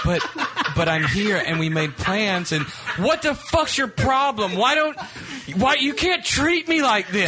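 high, slow, pleading whine; whiny girlfriend. Retold or imitated. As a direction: play the upset girlfriend on the phone. Acted quarrel as the girlfriend.

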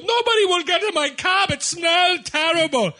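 high, loud whiny complaint; complaining cabbie. Retold or imitated. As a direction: play the desperate cabbie, whining.

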